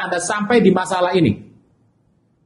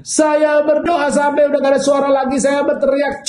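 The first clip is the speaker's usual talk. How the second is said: desperate shout; 7 semitones higher than usual. Acted. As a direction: yell like someone who has prayed her voice away. Desperate congregant tells pastor. (jemaat putus asa berteriak kepada pendeta dengan suara tinggi)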